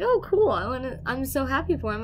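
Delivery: high, loud burst of happy excitement; delighted reaction. Retold or imitated. Storyteller replays being so happy for him, delighted.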